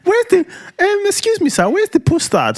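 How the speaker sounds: very high, hammed-up polite query; mock confused partner. Husband mimics wife asking the dealer, mock confused. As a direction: put on her voice, squeaky high and searching. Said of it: "she's probably looking around like".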